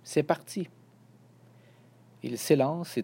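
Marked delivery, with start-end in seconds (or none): 0.0-0.7 mild narrated exclamation; calling out. A narrator's reading. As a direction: announce the launch with a lifted voice. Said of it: "crie Émile".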